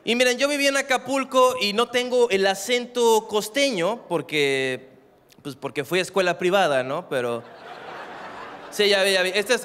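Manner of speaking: ordinary talk, nothing marked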